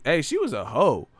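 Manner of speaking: usual speaking voice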